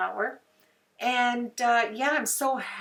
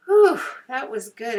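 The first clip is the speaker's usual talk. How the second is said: relieved whew; 4 semitones higher, 9 dB louder than usual. Real, not acted. Relief after two-hour craft session.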